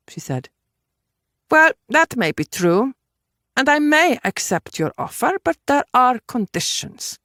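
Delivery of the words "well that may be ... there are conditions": higher-pitched, deliberate woman negotiating; concede, then lay down terms; firm character voice, pitched up; Judith, assertive. Acted. Voices Judith setting conditions.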